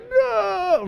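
high, wailing no; anguished no. Retold or imitated. Husband parting from his wife, high anguished wail. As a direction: cry out at the goodbye. Emotional goodbye at the car.

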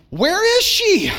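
high, loud, exasperated call; waiting at the door, fed up; impatient. Retold or imitated. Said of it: "I would stand at the door".

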